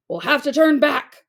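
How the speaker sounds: calling out. A narrator's reading. A pirate calls out over the storm, loud call. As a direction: call it across the deck. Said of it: "called another".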